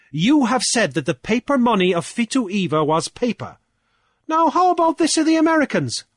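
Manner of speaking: high, loud declaiming challenge; Cornelius, triumphant orator. A narrator's reading. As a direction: orate forcefully to a whole assembly.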